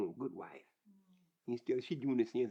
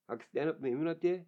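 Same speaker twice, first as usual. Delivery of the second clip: ordinary talk, nothing marked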